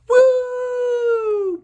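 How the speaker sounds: high loud whoop of someone flung through the air; flying whoop. Acted. Trids booted off the mountain, high loud whoop.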